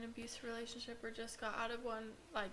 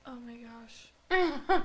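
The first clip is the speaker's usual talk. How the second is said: drawn-out, louder oh my gosh; stunned gasp; 9 dB louder, much slower than usual. Retold or imitated. Friend reacting with slow, stunned disbelief. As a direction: let it stretch out in shock. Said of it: "And she was like".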